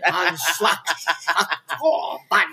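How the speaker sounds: mock German accent. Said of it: "They talk hard".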